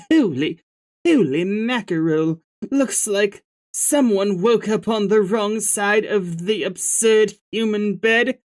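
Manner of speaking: a light hint of a British accent, troll; lightly put-on British accent. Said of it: "should I give you, like, a British voice?".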